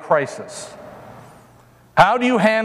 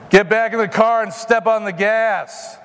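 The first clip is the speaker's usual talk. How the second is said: ordinary delivery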